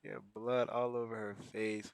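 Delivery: soft, low repeat; quiet retelling. Real, not acted. Repeating to himself about Granny's face, quietly. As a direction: say it again quietly, letting it sink in.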